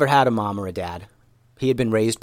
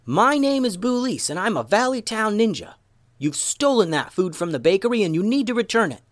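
high, declamatory calling out without much volume; yelled challenge; 10 semitones higher than usual. A narrator's reading. Ninja challenges the unseen thief under the bridge, yelling out. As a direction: announce yourself in a high, bold voice. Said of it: "Boulise yelled out".

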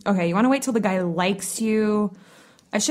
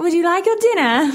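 high, laughing British nanny; a dog owner in a British nanny voice, laughing through it; 10 semitones higher, 5 dB louder than usual. Pretend. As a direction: put on a sing-song British nanny, cracking up. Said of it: "Do the voice".

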